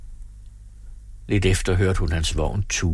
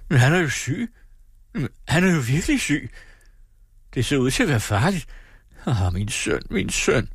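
father's lament, pitched high; wailing father; 6 semitones higher than usual. Acted. Father over his sick son, wailing high. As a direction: mourn his son aloud. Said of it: "jamrede han".

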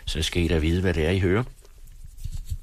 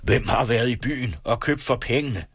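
higher-pitched mock thunder at the children; 6 semitones higher than usual. A narrator's reading. Father interrogating his children about the money, thundering. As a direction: thunder it as a father demanding answers. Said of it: "tordnede han til børnene".

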